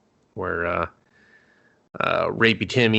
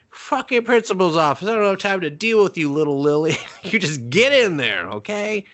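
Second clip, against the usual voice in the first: high, hammed-up teacher, fast in the middle; fed-up teacher; 7 semitones higher than usual. Host imitates a teacher sending little Lily off, hammed-up. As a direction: play a fed-up teacher brushing a student off. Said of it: "He's just like".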